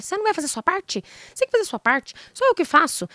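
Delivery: high, nagging demand; demanding wife. Retold or imitated. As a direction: demand it, with some pitch and edge. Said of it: "Não sei o que, exigência".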